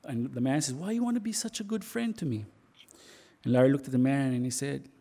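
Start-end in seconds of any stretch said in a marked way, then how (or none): 0.7-2.5 lightly voiced puzzled man, higher pitch; retold plea to know why; puzzled questioning. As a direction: ask in a higher voice, a touch bewildered. Man questions his rescuer.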